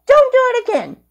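"Don't do it again" squeaked very high; squeaky high scolding. Acted. Repeated scolding, high take.

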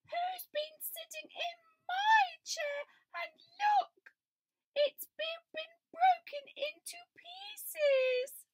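high squeaky cub, slow and teary; Small Bear. A narrator's reading. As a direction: wail it like a tiny upset bear. Small Bear voiced in story.

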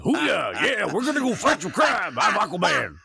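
put-on high, loud good-ol'-boy hero voice; hammy Southern trucker superhero; Southern trucker Aquaman. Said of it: "chose the voice of a, of a, a Southern trucker".

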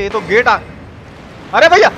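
ordinary talk, nothing marked

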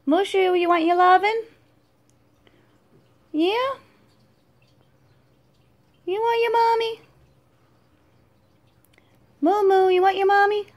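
high, fast sing-song cooing at a pet. Real, not acted. Mom coos to her dragon Mushu in high baby talk.

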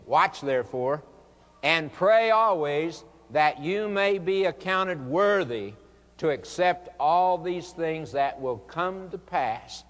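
loud, drawn-out scripture proclamation; forceful, measured Bible exhortation; preached scripture. Acted.

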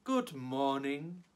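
a pleased, quiet hello with a smile; smiling reply. Acted. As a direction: Elmer pleased not to be recognized. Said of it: "Elmer smiled and said".

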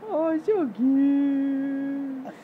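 high slow cooing. Real, not acted. Cooing at toddler.

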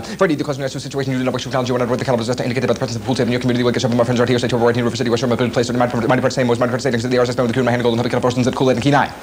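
blazing fast garbled patter; super-fast talking. Shown as a demonstration. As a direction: rattle the song off at top speed. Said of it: "it's a little fast to understand".

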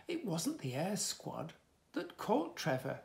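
ordinary delivery, nothing marked

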